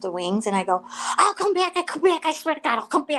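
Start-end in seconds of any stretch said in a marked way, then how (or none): 1.2-3.2 high, loud pleading to stop; panicked plea, half laughing at herself; retold desperate begging. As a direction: beg him to quit, voice pitched up in pain. Begging the tattoo artist to stop.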